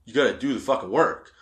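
normal talking voice

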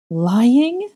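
high, loud shocked rise; gasped question. A narrator's reading. Wife shocked by her husband's words, loud gasping question. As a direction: gasp it in disbelief. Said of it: "Narcissa gasped".

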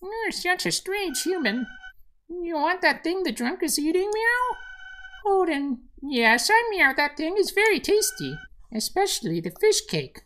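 very high, squeaky kitty voice; tiny high cat voice with meowed words; talking cat. Acted. Cat chatting to a strange human, high kitty voice. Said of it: "And the cat says".

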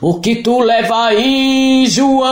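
monstro gigante, alto e arrastado; booming monster. Acted. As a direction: grita de cima, bem devagar. (monstro gritando)

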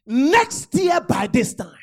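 slow loud shout; shouted declaration. Real, not acted. Preacher shouts the promise to the congregation. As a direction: hammer each word out. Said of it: "preacher repeats with force".

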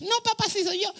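comic high-pitched impostor; [name]. Acted. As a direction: pretend to be your brother in a falsetto. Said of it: "Hablaba así".

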